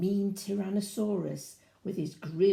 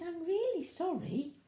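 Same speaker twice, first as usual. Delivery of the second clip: lightly raised, small polite dinosaur voice; little dinosaur voice; 8 semitones higher than usual. Acted. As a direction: voice the meek baby dinosaur, higher and gentle. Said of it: "And he said".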